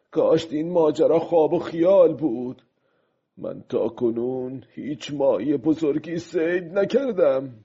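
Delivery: lightly voiced fisherman thinking to himself; a hint of character, musing over the catch; pensive old fisherman. Old fisherman's inner thoughts. (ماهیگیر پیر، کمی نمایشی، در فکر)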